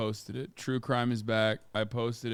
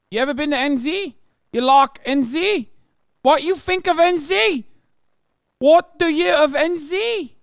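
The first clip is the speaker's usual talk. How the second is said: hammed-up New Zealand accent, much higher and louder; shrill Kiwi caricature; 12 semitones higher, 8 dB louder than usual. Streamer yelling at a Kiwi chatter in a mock accent. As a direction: scream it like a mad Kiwi bloke.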